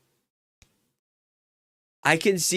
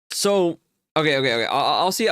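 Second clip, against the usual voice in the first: normal talking voice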